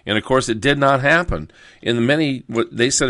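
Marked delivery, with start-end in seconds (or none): none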